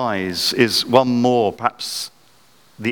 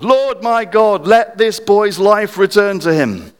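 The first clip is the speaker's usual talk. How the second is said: loud, slow drawn-out plea; pleading prayer; 9 semitones higher, 5 dB louder, slower than usual. Retold or imitated. Elijah stretched over the boy, pleading slowly and loudly. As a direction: plead slowly for the boy's life. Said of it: "cried out to the Lord".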